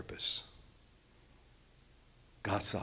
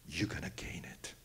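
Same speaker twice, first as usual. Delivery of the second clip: much softer, lower hush; whispered ending; 6 semitones lower, 13 dB quieter than usual. Real, not acted. Preacher quoting Jesus on gaining life, whispered. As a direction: let the promise fall to a whisper.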